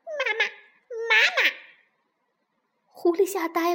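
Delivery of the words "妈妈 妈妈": put-on high, chirping baby duckling voice; duckling calling out. A narrator's reading. Newborn duckling rushes at the fox's nose, high calling. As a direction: cry out for mama, high and eager. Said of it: "叫着".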